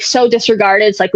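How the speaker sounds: usual speaking voice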